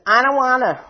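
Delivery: put-on loud, high toddler whine; whiny two-year-old. Wounded child refusing, like a whiny two-year-old. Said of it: "it's a two-year-old".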